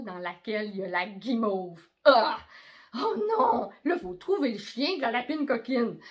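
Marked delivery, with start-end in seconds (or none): 2.0-2.4 startled ah. Acted. Surprised gasp in story. 2.9-3.7 high, loud cry of dismay. Acted. Dad telling a story, dismayed cry.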